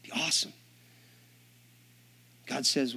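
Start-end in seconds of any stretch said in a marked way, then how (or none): 0.0-0.5 softly voiced wish, dropping to a whisper; hushed mock wish. Preacher voicing eager believers, hushed. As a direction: breathe it out like a secret longing.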